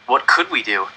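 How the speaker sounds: plain reading voice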